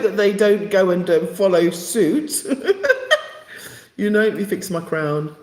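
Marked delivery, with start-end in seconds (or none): 2.5-3.2 laugh. Real, not acted. Laughs after hoping.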